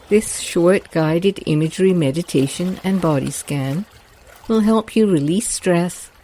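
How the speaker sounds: ordinary delivery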